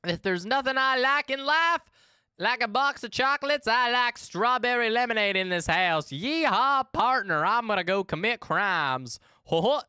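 high-pitched, hammed-up Southern voice; Southern accent. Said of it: "I can just do a Southern voice".

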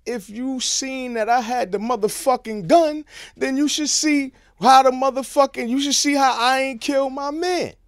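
loud, high furious protest; indignant yelling at police; angry outburst. Retold or imitated. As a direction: shout back at the cops. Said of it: "I'm like".

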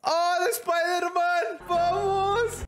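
high, excited victory shouts; elated, high-pitched hype; ecstatic celebration. Real, not acted. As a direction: cheer wildly at the top of your range. Celebrating escape from ringtone videos.

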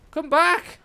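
loud, high yell after it; desperate loud call; shouting. Real, not acted. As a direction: call it back at the top of your voice. Yelling after the fleeing bird.

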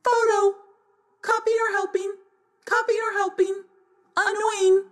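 high squawking parrot echo; high birdlike chorus. A narrator's reading. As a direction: squawk the words back like a mimicking bird. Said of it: "said the macaws together".

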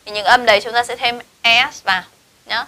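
ordinary delivery

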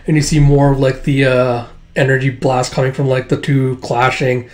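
usual speaking voice

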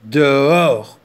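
loud gruff command; bear ordering. Acted. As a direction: bark it out as an order. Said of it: "ordonna l'ours".

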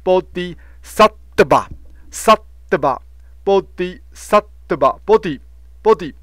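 bodhisattva as bo-di-sat-te-va, syllables separated; monks' pronunciation. Shown as a demonstration. Teacher showing how monks read it, "bodhisattva" in separated syllables. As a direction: slowly sound out "bodhisattva" with a small te before va. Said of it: "我看法师会把它念成".

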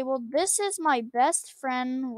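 usual speaking voice

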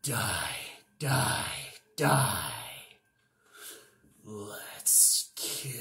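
low, soft menacing voice; Reaper voice. Acted. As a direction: growl it quietly like a death figure. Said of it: "The Reaper".

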